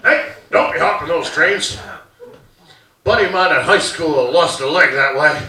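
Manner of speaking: lightly voiced stern dad; hint of a father's stock caution; dad's warning. Dad warns son off trains, mildly stern. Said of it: "My dad used to recycle stupid shit".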